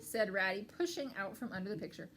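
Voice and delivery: ordinary delivery, nothing marked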